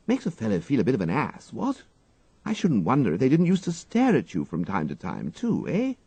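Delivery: surprised and prying, the gentleman; curious young toff. A narrator's reading. Wooster quizzes his valet about giggling girls, eager toff.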